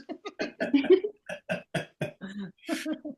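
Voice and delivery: real laugh climbing high; high rising laugh. Real, not acted. Laughing at his own joke about sports bettors, high rising laugh. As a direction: let a long laugh ride up high.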